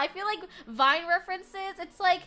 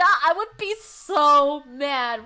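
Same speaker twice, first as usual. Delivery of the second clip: slow, louder mock fury; mock outrage; 9 dB louder, much slower than usual. Joking to chat about being born Gen Beta, slow mock anger. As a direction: drag it out in fake anger.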